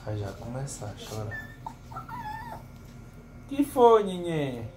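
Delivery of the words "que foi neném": high, cooing pet talk. Real, not acted. Owner cooing to his whining dog in baby talk.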